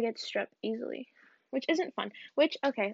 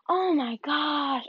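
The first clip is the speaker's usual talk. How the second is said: drawn-out shocked gasp of pain; slow, slightly higher cry of pain; slow pained exclamation; 4 semitones higher, 4 dB louder, much slower than usual. Real, not acted. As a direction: stretch it out as the pain hits. Just hurt her wrist.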